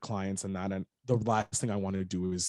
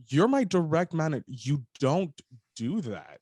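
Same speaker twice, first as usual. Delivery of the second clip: retold indignation; 6 semitones higher than usual. Retold rebuke of the manager.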